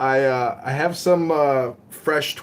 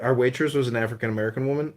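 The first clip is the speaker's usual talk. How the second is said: ordinary delivery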